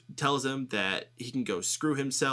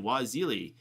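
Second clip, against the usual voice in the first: ordinary talk, nothing marked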